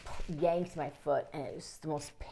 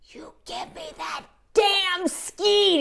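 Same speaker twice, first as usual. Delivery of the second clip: higher, louder, slow furious demand; retold angry demand; 9 dB louder, much slower than usual. Hurt boy swearing at father for the ski, angry. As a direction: spit it out at dad, slowly.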